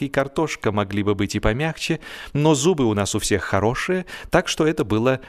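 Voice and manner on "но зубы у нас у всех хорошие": ordinary delivery, nothing marked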